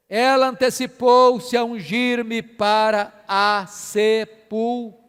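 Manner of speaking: slow, measured scripture reading; slow verse reading. Real, not acted. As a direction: spell out the verse piece by piece, drawing out the words. Reads the verse out slowly.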